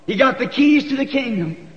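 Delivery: ordinary talk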